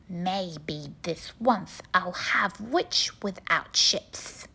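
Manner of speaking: low, gloating dragon; a hungry dragon savouring; deep smug dragon. A narrator's reading. Dragon speaks again.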